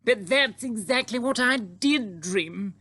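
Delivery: high, loud, slow astonished queen; amazed royal outcry; crying out. Acted. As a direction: cry it out high, stretching the words. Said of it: "the Queen cried out".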